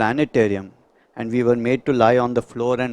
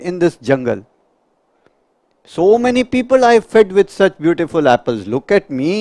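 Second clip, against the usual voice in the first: loud, higher bragging tree voice; boastful apple tree; 5 semitones higher, 7 dB louder than usual. Acted. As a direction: show off your sweet apples. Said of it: "the apple tree starts talking to you".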